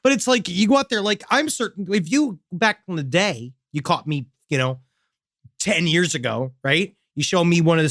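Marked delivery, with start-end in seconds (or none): none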